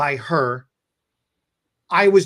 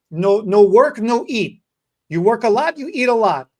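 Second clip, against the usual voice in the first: louder, higher broken-English advice; quoting Carmelina's grandma's saying to the cashier; 4 semitones higher, 7 dB louder than usual. Retold or imitated. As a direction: declare it like grandma's proverb, loud. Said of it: "She says this advice all the time".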